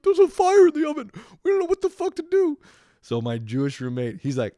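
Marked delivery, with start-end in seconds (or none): 0.0-2.6 high, loud, frantic panic, hammed up; mock panic. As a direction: play helpless rich kids freaking out. Privileged roommates panicking at fire.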